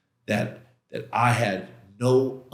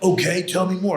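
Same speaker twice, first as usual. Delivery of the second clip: impatient, higher and louder push; a mock-angry demand for more; getting angry; 9 semitones higher, 4 dB louder than usual. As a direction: demand more, losing patience. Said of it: "And I'm getting angry".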